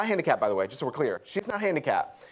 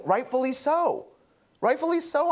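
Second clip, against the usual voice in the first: indignant Jonah; 11 semitones higher than usual. Acted. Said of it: "he's thinking".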